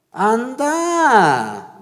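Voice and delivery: high, loud divine command; commanding shout. Acted. The Lord sending Samuel off, a high loud command. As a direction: call it out like God sending him off.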